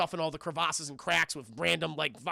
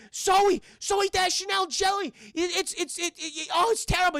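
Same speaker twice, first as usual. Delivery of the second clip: high, loud, frantic mock cry; hammed-up distress, tripping over words; panicked stammering; 11 semitones higher, 4 dB louder than usual. Mark Wahlberg calls to his wife, high panicked stammer.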